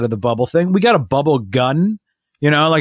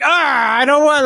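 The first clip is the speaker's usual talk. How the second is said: put-on high, whining little-kid voice; a mock toddler protest, pitched well up; imitating a whiny toddler; 11 semitones higher than usual. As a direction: mimic the toddler pushing the latch away. Toddler refusing the backpack latch.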